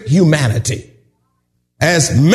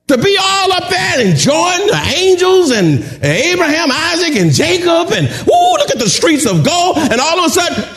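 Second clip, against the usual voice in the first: excited wonder; 9 semitones higher than usual. Retold or imitated. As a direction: gush over the streets of gold. Imagining heaven's glory excitedly.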